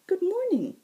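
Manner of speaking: high, ringing, lilting fae voice; bright high herald. Acted. Fae herald greeting the wounded Zack, high and bright. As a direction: sing it out high and sweet.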